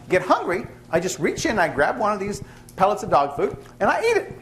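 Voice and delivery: man explaining. Retold or imitated. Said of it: "He says".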